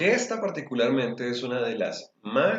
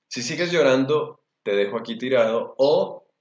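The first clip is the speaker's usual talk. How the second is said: threatening parent; 3 semitones higher, 8 dB louder than usual. Retold or imitated. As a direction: threaten the child sharply, near shouting. Parent threatening a crying child.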